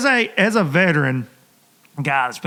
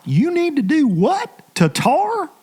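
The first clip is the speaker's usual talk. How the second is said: baffled local; 11 semitones higher, slower than usual. Retold or imitated. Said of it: "they're looking at him like".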